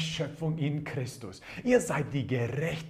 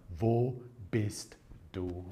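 very slow, lowered call, word by word; low, drawn-out divine question; God calling Adam; 5 semitones lower, 4 dB quieter, much slower than usual. Acted. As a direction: draw out each word of the searching question. Acts God calling after Adam.

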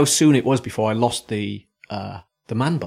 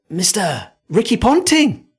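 imitated kids' shouting; 7 semitones higher, 4 dB louder than usual. Podcaster retelling kids running over on a beach, high mock shouting. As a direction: call out like kids spotting a celebrity. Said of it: "shouting and pointing".

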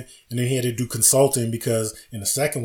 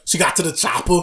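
louder, higher movie line; Arnold voice; 6 semitones higher, 4 dB louder than usual. Retold or imitated. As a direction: quote the action hero. Quoting Predator line.

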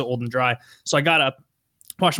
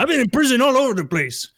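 put-on voice; 10 semitones higher than usual. Prisoner bragging to his cellmates, in a high put-on voice. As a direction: pitch it way up into a goofy character voice. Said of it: "I was gonna do snow voice".

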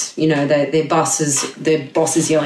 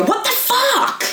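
high, slow, fed-up "what the fuck"; exasperated outburst; slower than usual. Retold or imitated. Stressed man snaps at a friendly mate, exasperated. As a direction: blurt it out, at the end of your rope. Snapping reply after awful morning.

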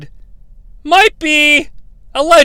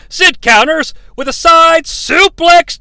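ordinary talk

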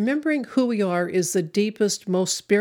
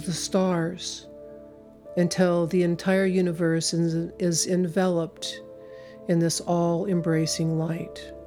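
guided meditation. Acted. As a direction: guide them gently, long pauses. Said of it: "take you through a meditation".

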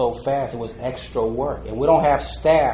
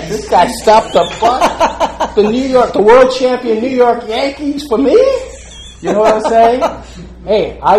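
laughing hard; 9 semitones higher, 9 dB louder than usual. Real, not acted. Laughing at his own story.

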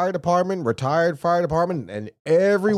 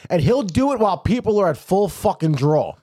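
usual speaking voice

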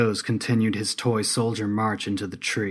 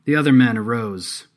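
usual speaking voice

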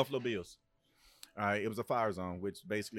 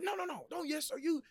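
much higher mock coach tirade; angry coach. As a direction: berate the player like an irate coach. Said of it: "He said".

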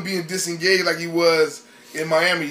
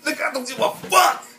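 mock Durant rant; 8 semitones higher than usual. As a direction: brush off the titles like a frustrated star. Voicing Durant's imagined rant.

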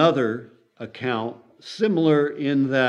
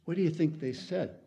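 normal talking voice

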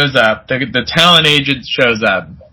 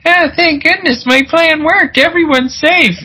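much higher, relieved falsetto; mock relieved guy; 14 semitones higher than usual. Head-idea guy celebrating his plan, high relieved falsetto. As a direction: squeal with relief like a proud fool. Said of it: "he was like".

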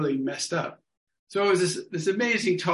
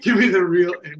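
eager demand; 2 semitones higher, 8 dB louder than usual. Retold or imitated. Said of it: "looks at me and goes".

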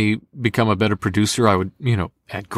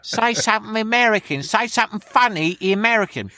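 very high, eager Essex local; Essex accent; 11 semitones higher than usual. Retold or imitated. Essex locals quizzing the visiting American, high Essex accent. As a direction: pester in a high-pitched mock Essex accent. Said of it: "everybody talk like this".

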